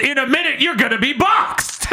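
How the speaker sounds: high-pitched yell from the crowd; mock heckling shout. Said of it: "someone goes".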